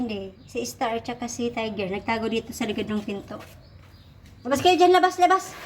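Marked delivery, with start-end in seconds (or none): 4.4-5.5 loud, high shout to come out; urgent loud shooing call. Real, not acted. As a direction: call them out from behind the door. Calling cats out from behind door.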